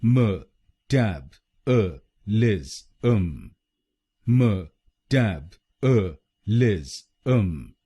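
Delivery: म टैब अ लिज अम spelled out slowly, each syllable apart. Shown as a demonstration. Teacher spelling out metabolism slowly, syllable by syllable. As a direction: break metabolism into slow separate syllables. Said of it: "हम बोलेंगे".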